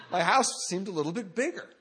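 said through a real laugh, pitch up; laughing at his own punchline. Real, not acted. Telling the crowd his house grew, laughing.

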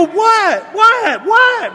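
high, loud startled yelps; rising alarm on each repeat; hammed-up hypochondriac panic; panicked reaction. Acts out own panicked reply.

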